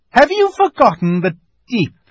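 high, loud imperious witch; witch's challenge. A narrator's reading. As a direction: challenge them as a haughty witch. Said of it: "asked the witch".

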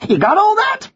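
very high American parrot; high-pitched American wise guy; American accent. Acted. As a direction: check in a high American bird voice. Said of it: "you have an American accent".

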